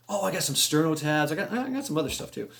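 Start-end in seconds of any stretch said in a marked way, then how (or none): none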